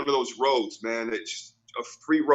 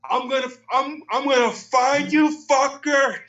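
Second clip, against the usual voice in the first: high, louder mock yell of a furious driver; imitated angry threat; 11 semitones higher, 4 dB louder than usual. Driver threatening hidden kids, high mock shout. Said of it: "He goes".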